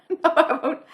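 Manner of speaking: promising the physio, laughing; laughing reply. Real, not acted. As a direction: answer through a laugh.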